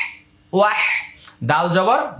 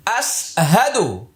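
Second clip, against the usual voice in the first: slow recitation; slower than usual. Shown as a demonstration. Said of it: "এবার মুখস্থ করে নিন".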